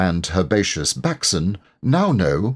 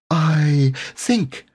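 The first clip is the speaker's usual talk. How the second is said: high, timid start from Piglet; nervously; 10 semitones higher than usual. A narrator's reading. Piglet timidly starting to speak to Eeyore, high and nervous. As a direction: venture it shyly, ready to stop. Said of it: "began Piglet nervously".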